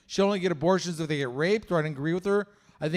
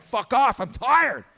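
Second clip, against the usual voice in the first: mock annoyed yell; 6 semitones higher, 5 dB louder, much slower than usual. Yelling at dog at night.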